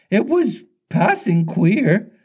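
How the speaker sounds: high, slow henchman voice; pirate sidekick. A narrator's reading. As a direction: remark it high and drawn out. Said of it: "Smee said".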